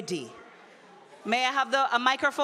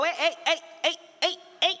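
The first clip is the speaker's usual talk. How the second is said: high, raised calls over the crowd; quick high calls for attention; calling out; 7 semitones higher, much faster than usual. Real, not acted. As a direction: call the room to order with repeated heys.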